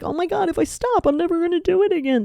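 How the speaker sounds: high, quick panicky voice; mock panic. Mocking a listener afraid to stop, high panic. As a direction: spiral into fake alarm. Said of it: "Don't go into panic".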